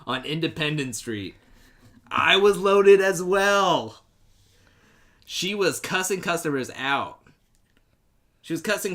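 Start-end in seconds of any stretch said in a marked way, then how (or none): 2.1-4.0 slow loud hammy drawl; dramatic reading. Acted. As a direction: stretch it out loudly.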